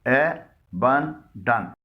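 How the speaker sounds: एबंडन drawn out very slowly in three syllables. Shown as a demonstration. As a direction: lay out एबंडन one slow syllable at a time. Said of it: "इसके भी तीन हिस्से हैं".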